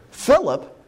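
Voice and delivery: normal talking voice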